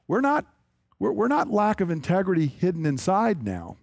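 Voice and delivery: normal talking voice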